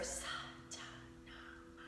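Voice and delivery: whispered mantra syllables. Shown as a demonstration. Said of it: "we're gonna whisper".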